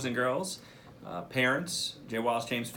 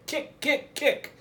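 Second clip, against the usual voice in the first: high, loud coaching shout; shouted command; 7 dB louder, much slower than usual. Acted. Grandpa shouting to Dot as she jumps, loud high shout. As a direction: bark the kicks like a coach. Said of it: "he shouted".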